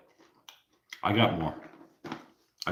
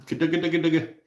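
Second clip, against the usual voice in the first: sing-song nickname; 7 semitones higher, faster than usual. Pretend. Trying out a silly nickname.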